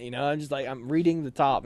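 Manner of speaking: normal talking voice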